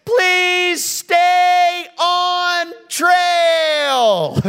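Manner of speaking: high, loud, drawn-out yell; child yelling. Retold or imitated. Boy yelling sign back to dad, long high shout. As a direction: stretch it slowly across the distance. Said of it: "And Jared yells out".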